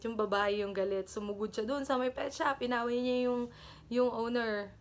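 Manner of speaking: ordinary talk, nothing marked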